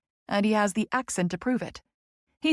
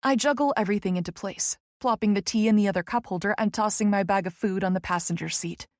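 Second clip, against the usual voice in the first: usual speaking voice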